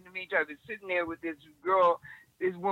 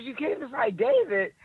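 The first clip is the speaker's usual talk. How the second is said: incredulous echo; 7 semitones higher, 3 dB louder than usual. Retold or imitated. Bodyguard echoes her in disbelief.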